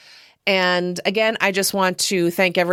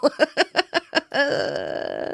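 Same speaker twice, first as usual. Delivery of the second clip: laugh; 8 semitones higher than usual. Real, not acted. Podcaster amused by their own dig, giggling. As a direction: giggle trailing into a long exhale.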